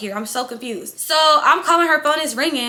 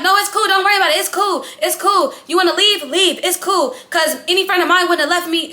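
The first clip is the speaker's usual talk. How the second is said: high, loud mock yell with a laugh; retold yelling; 6 semitones higher, 5 dB louder than usual. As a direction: act out her yelling, pitch up and loud. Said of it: "She's yelling".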